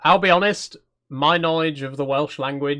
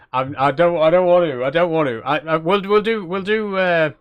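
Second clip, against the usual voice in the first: higher, stammering reluctance; reluctant protest; 3 semitones higher, 4 dB louder than usual. Real, not acted. Streamer dreading a Welsh accent on request, reluctant protest. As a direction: balk with rising pitch, stumbling over your words.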